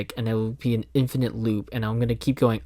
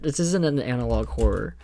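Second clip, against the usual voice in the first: normal talking voice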